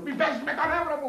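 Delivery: exasperated plea. Acted. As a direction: let it crack up high in exasperation.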